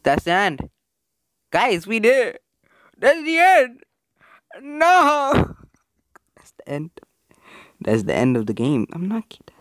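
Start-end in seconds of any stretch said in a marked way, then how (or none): 3.0-5.6 high loud disbelief; anguished high cry. Real, not acted. Gamer can't accept the ending, high loud wail. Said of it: "reacts to ending". 6.4-6.9 quiet. Real, not acted. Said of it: "repeats flatly". 8.9-9.6 hushed whisper. Real, not acted. Gamer insists the game is over, whispering.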